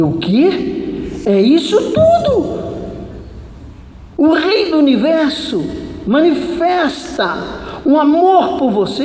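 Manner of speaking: high, loud, indignant proclamation; retold outraged outcry for all to hear; loud outburst. Preacher rebukes girl crying over another man, loudly indignant. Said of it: "e falei alto".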